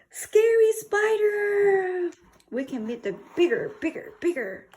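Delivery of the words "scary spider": high, loud, slow "Scary spider!"; mock scared shout. Teacher shouting a mock-scary spider reveal, high and slow. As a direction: ham up the spooky reveal.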